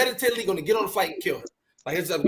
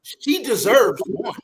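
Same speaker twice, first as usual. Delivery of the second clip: calm advice; 4 dB louder, much slower than usual. Retold or imitated. Cousin's wise advice quoted.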